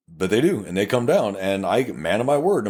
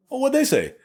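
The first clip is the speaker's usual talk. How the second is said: much higher, eager prodding question; eager prodding cop. Retold or imitated. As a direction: egg the suspect on, keen to hear more.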